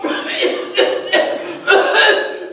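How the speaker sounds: spluttering water-choked cough; acted choking cough. Boy choking in the pool, spluttering cough.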